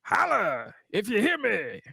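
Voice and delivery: high, drawn-out wrestling catchphrase; slow, high mock holler; [name]. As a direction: stretch it out like a call to the crowd. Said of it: "I hollered".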